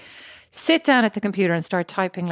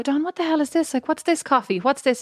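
exasperated demand; 6 semitones higher than usual. Retold or imitated. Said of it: "And I'll go".

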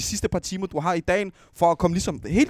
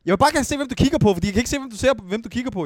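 higher, louder and fast, pressing him; urgently begging to see his eyes; insistent pleading; 6 semitones higher, 4 dB louder, much faster than usual. Real, not acted. Friend pressing another to take off his sunglasses, fast insistent plea.

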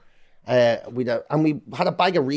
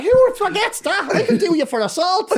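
laughing mock outrage; 4 dB louder than usual. Real, not acted. Mock outrage at forgetting the attack.

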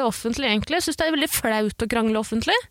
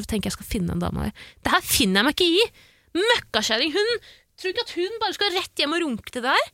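usual speaking voice

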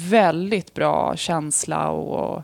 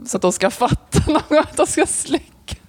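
higher voice breaking up with laughter; laughing; 10 semitones higher, 4 dB louder than usual. Real, not acted. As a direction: laugh through the words while telling your own prank. Laughs at own prank story.

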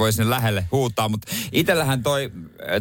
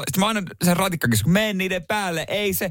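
higher-pitched, agitated retold rant; mock road rant; 7 semitones higher than usual. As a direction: act out yelling at the tram. Acts out own shouting in traffic. (kiukkuinen ratin takaa huuto)

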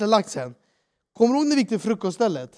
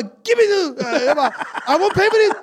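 much higher and louder furious shout; retold angry outburst; 8 semitones higher, 4 dB louder than usual. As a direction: yell it like you've lost it. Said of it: "jag var helt galen".

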